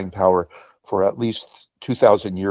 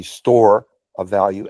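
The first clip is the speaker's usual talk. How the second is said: "Store" drawn out and louder than "of value"; stress on store; 4 dB louder, slower than usual. Real, not acted. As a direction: lean hard and slowly on "Store". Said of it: "emphasis on store".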